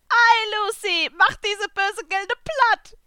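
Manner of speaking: squeaky cartoon cat, exclaiming; Happy the cat. A narrator's reading. The little blue cat explaining to Natsu, high and excited.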